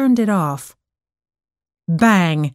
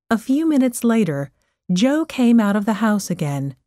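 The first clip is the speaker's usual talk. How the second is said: usual speaking voice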